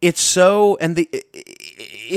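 normal talking voice

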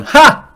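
sharp high loud "Ha!" of a listener; a listener's snorting "gotcha" laugh; mocking laugh. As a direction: bark out one scornful laugh. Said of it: "a client listening that's just gone".

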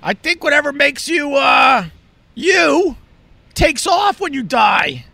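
excited realization. Retold or imitated. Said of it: "I went".

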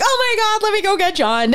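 high thrilled gush; excited. Retold or imitated. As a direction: burst out with joy. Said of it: "I'm like".

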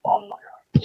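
whispered retold shock; softly breathed, imitating a stunned whisper; hushed disbelief. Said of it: "Everyone's just quiet".

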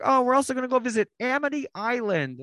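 high, fast, peppy tour guide announcement. Retold or imitated. Tour guide greeting the tram riders, high and peppy. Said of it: "the tour guide".

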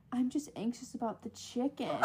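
very soft imitated confession; hushed little worried voice, retold; quietly anxious. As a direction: mimic a meek, anxious admission. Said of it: "like, anxious".

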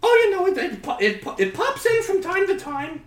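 put-on Hillary with New Jersey accent. New Jersey accent Hillary justifying her accent to Scott. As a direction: explain it away in high New Jersey voice. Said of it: "strong New Jersey accent".

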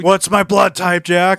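over-the-top villain. Retold or imitated. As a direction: ham it up like the unhinged baddie. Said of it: "over the top the way he was delivering those lines".

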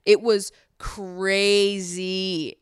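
very slow "It was crazy"; drawn-out emphasis. Real, not acted. Emphatic recap.

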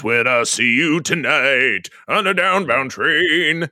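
slow sung line in a rough gravel voice. Retold or imitated. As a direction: croon it slow and gravelly like an old bluesman. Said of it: "sound like [name]".

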